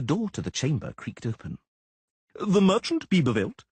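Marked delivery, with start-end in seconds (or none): 2.4-3.6 high, loud crowing call; crowing official. Acted. Official crowing for the merchant at the door. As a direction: crow the name into the room. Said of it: "crowed an individual".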